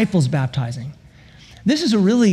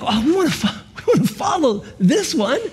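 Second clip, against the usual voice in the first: eager, laughing, higher voice of stoked disciples; 5 semitones higher than usual. Retold or imitated. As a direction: imitate the fired-up followers, half laughing. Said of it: "there's a stoke behind it".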